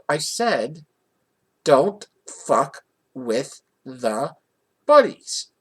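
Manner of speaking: word-by-word warning, last word punched; slow, emphatic warning. Retold or imitated. Repeating a warning to the father, slow and emphatic.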